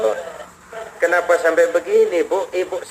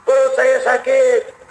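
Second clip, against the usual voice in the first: groaning in pain; 5 semitones higher, slower than usual. Acted. Groaning overfed man answers Umar.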